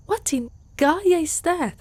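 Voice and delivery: sudden high, loud disbelief; baffled outburst. Acted. Centaur hears an unfamiliar word, loud baffled disbelief. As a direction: blurt it out, thrown by the strange word.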